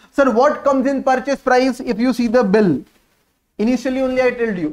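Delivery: ordinary talk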